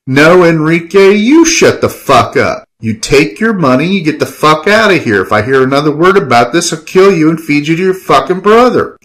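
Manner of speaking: high, heated, emphatic warning; angry threat. Acted. Killian ordering Enrique out, angry threat. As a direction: lay down a furious threat.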